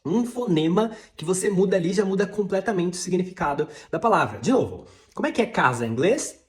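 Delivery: ordinary talk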